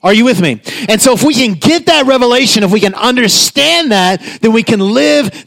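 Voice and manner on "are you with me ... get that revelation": ordinary delivery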